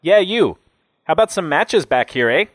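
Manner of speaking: high, loud brash demand; calling out. A narrator's reading. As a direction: holler a request to someone at a distance. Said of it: "he called out".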